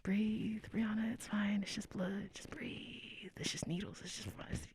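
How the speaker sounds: hushed whisper, coaching herself to breathe; whispered self-talk. Retold or imitated. Nervous patient whispering to herself during blood draw, whispered. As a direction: whisper it to yourself like you're trying not to panic.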